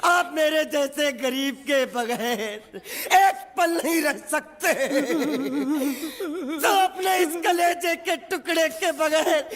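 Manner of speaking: melodramatic wailing. Acted. Servant telling master he cannot live without him, wailing. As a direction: sob it out theatrically, stretching the words.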